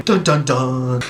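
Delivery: slow vocal dun-dun-dun sting; mock suspense music, drawn out; dramatic sting. Narrator punctuates the movie's plot twist, mock dramatic sting.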